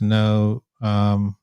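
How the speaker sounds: ordinary talk, nothing marked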